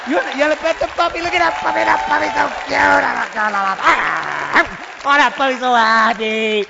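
put-on cooing pet voice; gushing over puppy. Showing off the puppy after the flight, gushing put-on coo.

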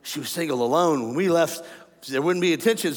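ordinary delivery

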